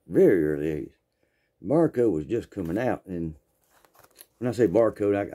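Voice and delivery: normal talking voice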